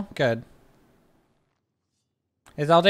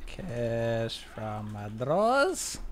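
slow, low, dragged-out words; half speed; 5 semitones lower, 8 dB quieter, much slower than usual. Shown as a demonstration. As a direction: stretch it like a slowed-down song. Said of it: "is great at half speed".

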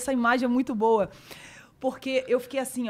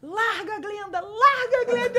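mock urging man; 11 semitones higher, 4 dB louder, slower than usual. Voicing the man behind her.

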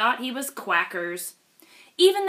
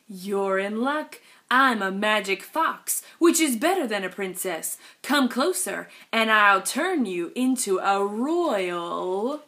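sly fox. Acted. Sly fox continues, luring.

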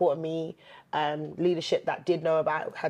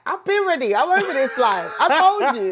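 exasperated retort; 9 semitones higher, 7 dB louder than usual. Retold or imitated. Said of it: "And I was like".

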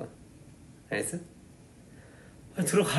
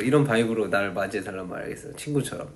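normal talking voice

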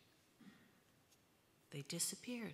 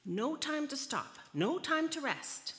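high, loud, insistent cries; shouted order; 11 semitones higher, 9 dB louder than usual. A narrator's reading. Ninth Leo pushing the other Leos on, shouting. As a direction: drive them on with two matching shouts. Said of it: "the ninth Leo shouted".

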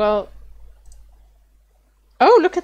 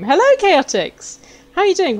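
high, loud welcome to the viewers; excited greeting; 8 semitones higher, 5 dB louder than usual. Real, not acted. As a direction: greet the chat with a burst of energy.

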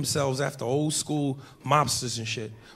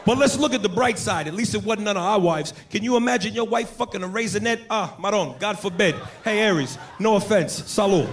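Italian-American toast; 5 semitones higher than usual. Retold or imitated. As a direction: raise a glass with Brooklyn bravado. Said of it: "starts telling this story to his boys".

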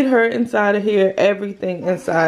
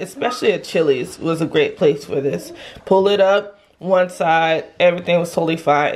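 ordinary talk

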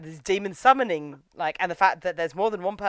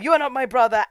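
raised, louder mock outcry; retold defiant denial, pitched up; mock defiance; 7 semitones higher, 5 dB louder, faster than usual. Joseph confronting the hooded figure, mock defiant.

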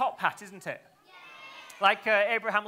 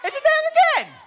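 very high, loud outburst; incredulous shriek; 11 dB louder than usual. Acted. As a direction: shriek in stage disbelief. Ball reappears after trick.

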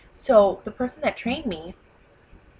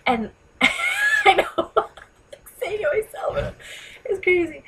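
laughing at herself, much higher; 12 semitones higher, slower than usual. Real, not acted. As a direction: laugh through the words. Laughs at her own pitch.

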